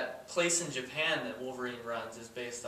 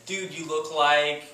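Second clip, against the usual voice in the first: teasing; 2 semitones higher, 9 dB louder, slower than usual. Retold or imitated. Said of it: "it's more like the whole".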